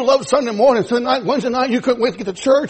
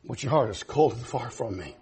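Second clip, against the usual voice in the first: much lower, soft voice of God; voice of the Lord; 12 semitones lower, 12 dB quieter than usual. Acted. The Lord answering the church, low and soft.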